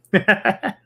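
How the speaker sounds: quick high laugh. Real, not acted. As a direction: burst out laughing. Laughing after uterus joke.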